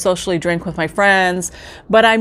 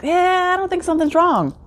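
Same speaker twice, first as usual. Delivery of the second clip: airy, much higher brush-off of the worry; dismissive denial; 10 semitones higher than usual. Retold or imitated. Brushing off her husband's worry, a high dismissive denial. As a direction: wave it away in a lighter, higher put-on voice.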